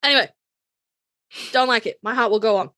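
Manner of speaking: ordinary talk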